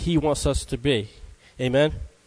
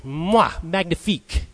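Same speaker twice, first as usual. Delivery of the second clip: slow mock French chef; hammed-up French accent, slow and proud. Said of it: "like a French chef".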